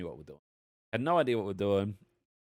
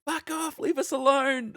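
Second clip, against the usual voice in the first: very high, exasperated mock yell; retold angry yell; 5 dB louder, slower than usual. Player yelling at crew that hunts him, retold angry yell. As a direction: plead angrily, pitch way up, getting louder. Retells yelling back at the pirates.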